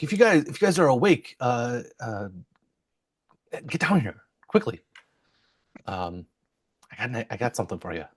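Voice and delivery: sly honeyed whisper; conspiratorial tone. Acted. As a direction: lean in, secretive and urgent. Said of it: "conspiratorial tone".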